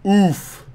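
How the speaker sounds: high yelped oof. Acted. Chat asks for an oof.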